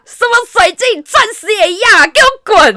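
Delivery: very high, loud kicking-out shout; furious yell. Retold or imitated. To the date, furious yell get out. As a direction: throw him out at full volume. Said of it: "然后我眼睛瞪更大".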